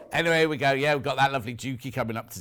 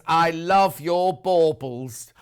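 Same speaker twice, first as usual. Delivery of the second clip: loud, very slow mock declaration; drawn-out quote; 8 dB louder, much slower than usual. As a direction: stretch out every word, loudly. Reads viewer's cheeky message aloud.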